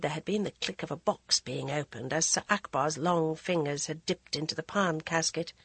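ordinary delivery, nothing marked

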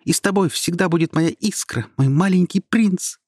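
high, fervent exclamation; cry out. Acted. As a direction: farewell cried out with all her fire. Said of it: "вскрикнула про себя звёздочка".

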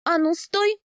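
stamping command. A narrator's reading. Heroine orders her husband to stop, high and insistent. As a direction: halt him with a sharp rise in pitch. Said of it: "топнула я".

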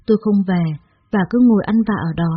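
usual speaking voice

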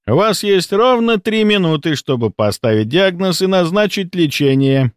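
high-pitched, gloating ultimatum; lofty, triumphant challenge. A narrator's reading. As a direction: pronounce the deadline like a judge of fates. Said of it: "торжествующе объявил Рудаков".

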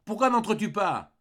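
high, loud, impatient demand; irritated yell; angry shout. A narrator's reading. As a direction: shout crossly from inside the hut. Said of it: "cria du fond de la cahute avec colère".

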